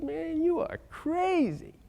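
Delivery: high, slow, drawn-out "you are crazy"; mocking doubter. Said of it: "people said".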